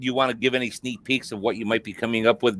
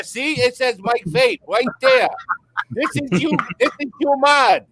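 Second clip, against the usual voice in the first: hammed-up overseas manufacturer voice; mock foreign company rep; 10 semitones higher than usual. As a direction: flatter the famous American, pitched up. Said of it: "these companies are just making them and say".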